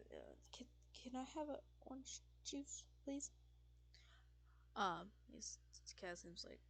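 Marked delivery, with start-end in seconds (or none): 0.1-3.3 hesitant, nervous order with a stumbling start; shaky Sullivan. Acted. Sullivan ordering orange juice from the bartender, shaky and nervous. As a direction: stammer softly into a timid request. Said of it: "kinda shakes".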